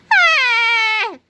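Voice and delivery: high, loud, sustained scream. A narrator's reading. Melvin runs from the angry mob, high scream. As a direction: scream in flight. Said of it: "screamed Melvin as he ran away".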